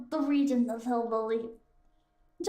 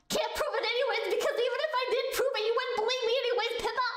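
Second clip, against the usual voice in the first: high, fast protesting voice; mock objector; 7 semitones higher, faster than usual. Streamer voicing riled-up people answering Pippa, high fast protest. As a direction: rattle it off as a high-voiced complainer.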